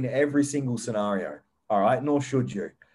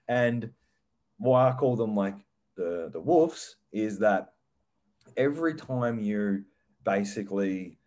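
ordinary delivery

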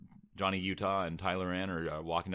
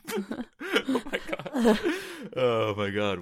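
laughing; 5 dB louder, much slower than usual. Real, not acted. Laughing at script.

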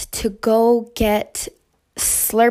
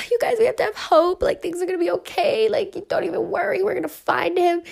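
much higher, sweet reassuring voice; reassuring friends; 9 semitones higher than usual. Retold or imitated. To her friends in the festival line, high soothing reassurance. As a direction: soothe the worried friends, lifted and bright. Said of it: "I was just like".

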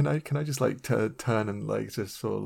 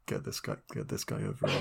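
low, much softer conspiratorial murmur; knowing aside; 12 semitones lower, 12 dB quieter than usual. Acted. Player to Eli, a quiet knowing aside. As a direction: mutter it to your friend with a knowing look. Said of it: "being like".

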